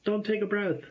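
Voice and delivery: high, urgent scolding voice; panicked inner voice yelling no, mostly pitch not volume; frantic inner voice. Retold or imitated. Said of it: "the other voice is like".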